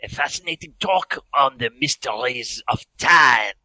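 put-on loud, theatrical French-accented professor; outrageous French accent. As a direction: interrupt grandly in a hammy French accent. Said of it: "he had an outrageous French accent".

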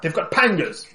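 high urgent warning shout. Acted. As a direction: call it out in alarm. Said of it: "I will shout".

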